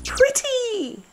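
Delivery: very high excited squeal. A narrator's reading. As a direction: squeal with delight at the butterfly. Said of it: "squealed Little Badger".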